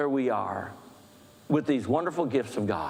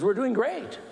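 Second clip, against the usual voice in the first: laughing; 9 semitones higher than usual. Retold or imitated. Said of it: "our friend the clock".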